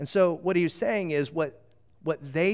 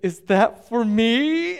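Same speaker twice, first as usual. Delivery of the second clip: put-on slow, higher, louder thrilled little girl question; drawn-out wonder of a kid seeing candy; delighted child; 5 semitones higher, 7 dB louder, much slower than usual. Father imitating his daughter finding candy, thrilled and slow. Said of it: "and she goes".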